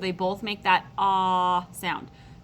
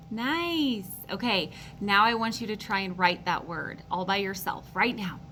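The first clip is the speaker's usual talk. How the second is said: normal talking voice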